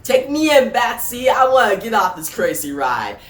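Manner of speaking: high, loud villain voice easing down; high-pitched theatrical plea to be taken away; comedian Joker. A narrator's reading. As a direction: play a manic clown villain, starting very high. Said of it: "And the comedian Joker says".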